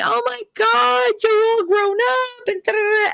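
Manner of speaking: smother her with delighted high cooing; gushing old aunt. Retold or imitated. Old aunt hugging grown niece, high gushing delight. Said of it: "this woman's clinging to me".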